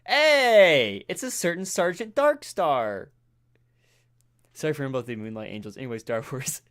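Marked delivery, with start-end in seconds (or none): 0.1-3.1 loud high greeting; calling out. Real, not acted. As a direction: call out across the room. Calling out to arriving friend.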